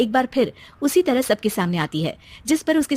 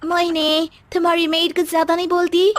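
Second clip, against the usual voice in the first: higher, sneering aside; snooty friend; 7 semitones higher than usual. Acted. Friend mocking the mother-in-law to Mohini, snooty. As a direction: look down on the old lady.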